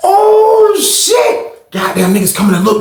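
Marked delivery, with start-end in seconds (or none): 0.1-1.5 high, drawn-out "oh shit"; retold amazement, pitched way up; acted shock. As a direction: wail it high in mock astonishment.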